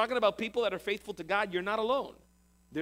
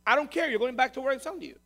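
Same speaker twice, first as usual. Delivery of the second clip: raised, loud, no-argument rebuttal; stern overruling; 7 semitones higher, 5 dB louder than usual. Acted. God dismissing Elijah's fear of going back, stern overruling. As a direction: cut off the objection forcefully.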